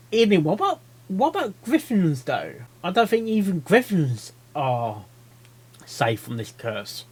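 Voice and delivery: ordinary delivery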